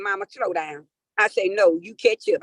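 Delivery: ordinary delivery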